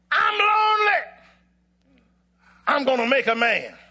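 high, loud, dramatic cry as God; impassioned preacher voicing God. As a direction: declaim it as God in a poem. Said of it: "And God said".

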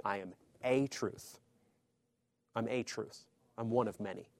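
"a" said long and stressed as "ay". Shown as a demonstration. Preacher contrasting "a truth" with "the truth", stressing "a". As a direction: lean on "a" as in "I am a truth". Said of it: "Jesus isn't saying".

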